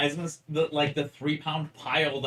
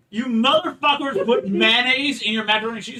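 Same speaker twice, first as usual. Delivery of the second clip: mock outrage; 8 semitones higher, 9 dB louder than usual. Outraged retold quote.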